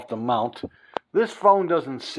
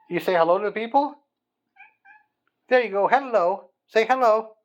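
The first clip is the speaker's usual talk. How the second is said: higher, sweet pet-talk voice; higher voice, fussing over his cat; 8 semitones higher, 4 dB louder than usual. Real, not acted. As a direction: coax the cat to greet the viewers. Coaxing cat to greet viewers.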